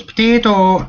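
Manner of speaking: high, loud mock Irish accent. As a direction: shout it as a comedian's mocking Irish accent. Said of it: "he does the accent and he goes".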